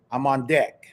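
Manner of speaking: usual speaking voice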